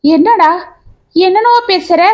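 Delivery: half-hearted alarmed outcry; crying out. Acted. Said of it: "என்று கோபி அலற".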